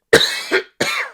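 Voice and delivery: a dry real cough from hay fever; sudden hay fever cough. Real, not acted. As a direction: cough it out, unplanned. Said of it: "Sorry, I was just coughing there".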